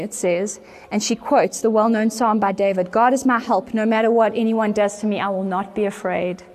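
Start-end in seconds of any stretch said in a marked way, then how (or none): none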